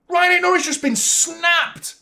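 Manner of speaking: high, loud and slow outcry; outraged yell. Real, not acted. Fan yelling to viewers about the injured player, outraged. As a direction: drag it out in disbelief.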